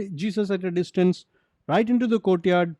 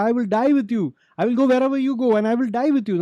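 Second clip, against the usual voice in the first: higher-pitched, insistent pledge; emphatic vow; 6 semitones higher, faster than usual. Acted. Peter vowing to die with Jesus, emphatic. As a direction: swear it emphatically, pressing on without a break. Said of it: "Peter was saying emphatically".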